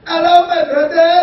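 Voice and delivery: loud, high drawling cowboy greeting; mock cowboy accent greeting; cowboy English. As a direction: boom it like a Texan pastor.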